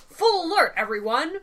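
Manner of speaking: barked ship order; commanding order. Retold or imitated. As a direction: call it like a captain on deck. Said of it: "as if he's ordering them on the ship".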